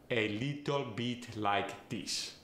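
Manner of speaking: musical monotone Farsi accent, a little bit said a lito pit; slow, musically monotone Farsi accent. Shown as a demonstration. Coach demonstrating a Farsi accent, slow musical monotone. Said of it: "I think of a musically monotone accent".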